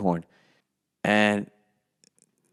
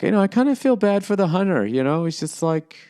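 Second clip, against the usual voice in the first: mock sympathetic writers; 8 semitones higher than usual. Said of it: "They're like".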